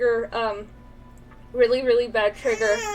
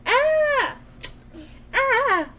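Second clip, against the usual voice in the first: startled cry of ah; 10 semitones higher than usual. Acted. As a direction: yelp in surprise. Reading a startled line aloud.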